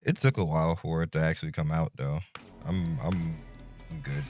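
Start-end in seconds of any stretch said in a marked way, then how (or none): none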